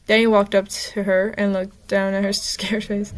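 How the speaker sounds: usual speaking voice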